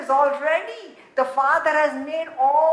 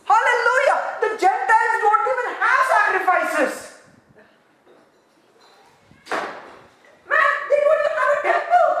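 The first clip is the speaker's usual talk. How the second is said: much higher, loud, joyful shout; ecstatic praise; 10 semitones higher, 5 dB louder than usual. Real, not acted. Preacher rejoicing that God's name reaches Gentiles, joyful shout. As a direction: erupt in joy over the promise.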